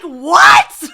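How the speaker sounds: high, loud shocked what; flabbergasted yelp; stunned shout. Retold or imitated. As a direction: shout it in disbelief. Stunned by confession.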